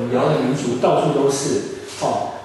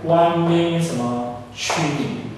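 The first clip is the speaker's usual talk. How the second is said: slow read-out; slower than usual. Shown as a demonstration. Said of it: "一百万个兆".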